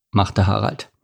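usual speaking voice

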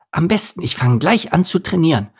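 put-on high, girlish character voice; cheerful resolve to train; high light character voice; Steffi voice.

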